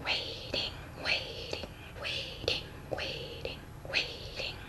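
breathless whisper, barely voiced. Real, not acted. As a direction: whisper so he can't hear. Hiding prankster waits quietly.

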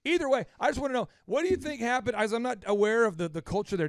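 usual speaking voice

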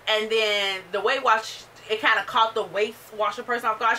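ordinary talk

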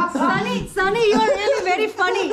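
high, giggling through the words; real laughter while telling Sonny he's funny; laughing. Real, not acted. Auntie laughing at the shopkeeper's accent lesson.

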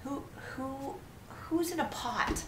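soft but high-pitched bewilderment; incredulous. Real, not acted. Player puzzling over the man in a pot, high incredulous question. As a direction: let disbelief start climbing.

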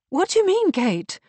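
sharp, high, loud demand. A narrator's reading. Beatrice challenges Kate over what she means, demanding. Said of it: "demanded Beatrice".

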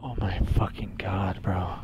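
breathless stunned whisper; awed whisper. Real, not acted. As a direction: whisper it in shock. Awed by horror game.

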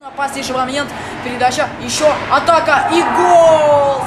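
building to a loud goal shout; rising hockey play-by-play shout; excited hockey goal call. Real, not acted.

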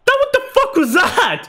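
high laughing outburst; laughing disbelief. Real, not acted. Gamer laughing at his winger's miss, incredulous laugh.

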